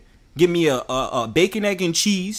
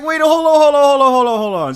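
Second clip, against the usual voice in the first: higher, louder startled wait, hold on; retold alarm; 9 semitones higher, 8 dB louder than usual. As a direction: cut in loud and high, caught off guard. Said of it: "I was like".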